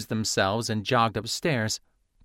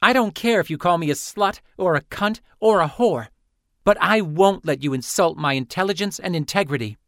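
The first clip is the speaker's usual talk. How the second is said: indignant; 7 semitones higher, 4 dB louder than usual. Acted. Rival fires back insults.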